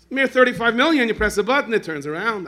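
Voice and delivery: high, loud ridiculing rant; preacher scoffing at a turning apartment; mocking outburst. Real, not acted. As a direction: blast the absurdity of the price. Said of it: "preacher ridiculing luxury".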